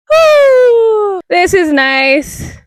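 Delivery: high joyful whoop, then slow delight; excited whoop. Real, not acted. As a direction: cheer out loud, then savour it. Joyful cheer on the trail.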